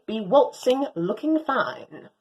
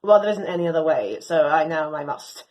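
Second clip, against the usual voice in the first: usual speaking voice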